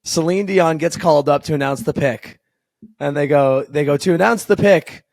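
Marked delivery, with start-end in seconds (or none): 4.0-5.0 put-on raised, stretched French-accented introduction; French-accented announcer. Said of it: "do it with a nice French accent".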